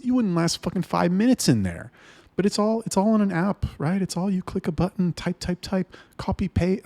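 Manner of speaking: frustrated and climbing in pitch; real exasperation at lazy chatters; worked up. Real, not acted. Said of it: "I get worked up about this".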